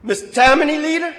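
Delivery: high, loud mocking title; hammed-up sneer at a rival; sneering jibe. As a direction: needle him with the label. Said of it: "Pratt was not a very nice man".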